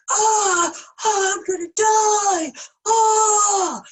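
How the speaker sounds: panicked scream. Retold or imitated. Scorpion splashed on the frog's back, hammed-up panicked screaming. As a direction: shriek like a terrified scorpion in a flood. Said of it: "He's gonna be like".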